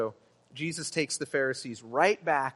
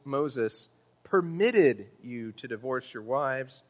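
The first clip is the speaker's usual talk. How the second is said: "permitted" drawn out and punched louder; stress on permitted; 3 dB louder, slower than usual. Shown as a demonstration. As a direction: lean slowly and hard on "permitted". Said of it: "clearly on the word permitted".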